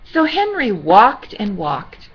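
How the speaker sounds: gentle storybook reading voice; sweet old lady. Acted. Reading to children.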